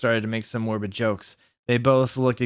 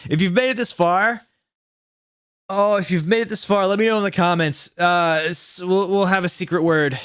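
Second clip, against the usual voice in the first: excited aside; 6 semitones higher, 4 dB louder than usual. Real, not acted.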